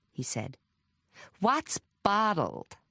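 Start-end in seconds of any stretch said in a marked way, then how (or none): 1.4-2.8 slow, higher American question; American visitor querying local slang; American accent. A narrator's reading. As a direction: ask puzzled in an American accent. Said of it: "Logan Royce had an American accent".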